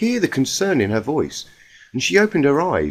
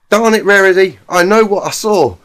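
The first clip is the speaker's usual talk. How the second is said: frustrated insistence; 6 semitones higher, 8 dB louder than usual. Acted. Applejack insisting angrily.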